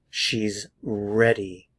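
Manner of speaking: "She's ... ready", slow with a gap between the words. Shown as a demonstration. Teacher models "She's ready" for learners, slow and word by word. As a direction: draw out "She's", pause, then a careful "ready". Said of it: "Pay attention to the vowel sound".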